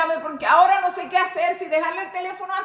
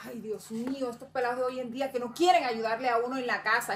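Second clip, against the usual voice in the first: ordinary delivery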